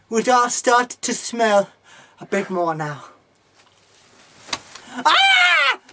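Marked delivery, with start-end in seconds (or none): 5.0-5.8 piercing high scream. Acted. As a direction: scream as if attacked. Screams in fight.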